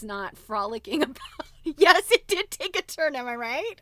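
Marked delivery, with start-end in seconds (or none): 1.6-3.7 much louder and higher, sarcastic glee; loud, higher sarcastic cheer; mock excitement. Real, not acted. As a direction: crow it out like a big reveal. Mock-excited reaction to the story.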